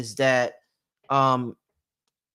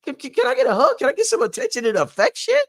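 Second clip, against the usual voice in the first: very high, loud mock woman's complaint; hammed-up high woman's voice; shrill girlfriend; 6 dB louder, faster than usual. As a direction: squeal it like an annoyed girlfriend. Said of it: "She be like".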